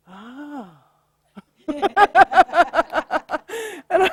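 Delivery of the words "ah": a hushed gasp of wonder, then a breathy ah; soft surprised gasp. Retold or imitated. Client suddenly seeing the path open, soft gasp. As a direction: catch your breath in amazement. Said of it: "all of a sudden she goes".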